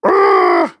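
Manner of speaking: high, loud gasp then a sharp "Ah!"; mock gasp and cry. Mimicking his own dismay at the animation, a loud high gasp and cry. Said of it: "I'm like".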